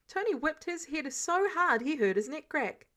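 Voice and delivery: ordinary delivery, nothing marked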